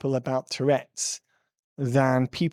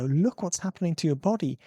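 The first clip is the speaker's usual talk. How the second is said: higher-pitched exasperated urging; pleading, insistent pep talk; urgent wake-up plea; 5 semitones higher than usual. Acted. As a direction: shake them up with your voice. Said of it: "shake people up and go".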